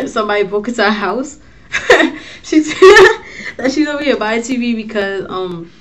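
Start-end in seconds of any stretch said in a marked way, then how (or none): 1.7-2.4 a burst of airy laughter; breathy laugh. Real, not acted. Laughing at the break-in detail. 2.8-3.5 high, loud burst of laughter; laughing hard. Real, not acted.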